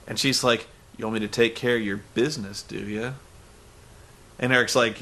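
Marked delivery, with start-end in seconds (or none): 1.0-3.1 low, soft mock seduction; suggestive come-on. Host mimicking her twisting business into sex, mock sultry. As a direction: murmur it as a leading question.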